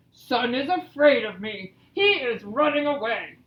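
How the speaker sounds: emphatic boastful bear voice; gloating Bear. Acted. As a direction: brag as a storybook bear. Reads Bear's gloating line.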